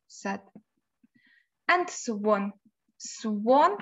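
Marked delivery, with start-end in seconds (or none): 0.1-0.4 sad dropped low and very soft. Shown as a demonstration. Teacher drilling sad against happy, soft and low. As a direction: let sad sink to a near whisper. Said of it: "Sad, sad, happy".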